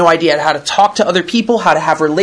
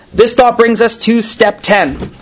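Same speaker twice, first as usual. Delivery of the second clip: normal talking voice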